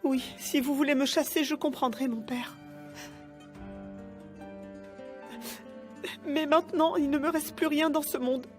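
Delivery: high tearful begging; crying plea. Acted. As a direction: sob out a plea for pity. Begs priest for pity, crying.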